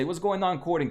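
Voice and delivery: ordinary talk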